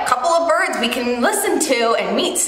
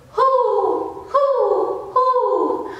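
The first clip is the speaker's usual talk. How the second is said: higher hoo hoo owl call; owl hoots; 7 semitones higher, much slower than usual. Shown as a demonstration. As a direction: hoot like an owl for kids. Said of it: "Can you sing some bird sounds with me?".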